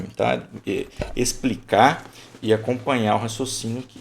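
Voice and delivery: ordinary talk, nothing marked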